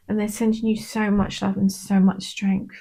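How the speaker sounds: ordinary talk, nothing marked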